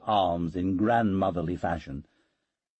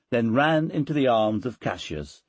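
ordinary delivery, nothing marked

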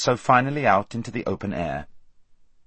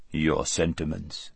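lower, softer imperial drawl; cold Tisroc; 5 semitones lower, 6 dB quieter, slower than usual. A narrator's reading. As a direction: speak as a smug, calculating emperor. Tisroc replies.

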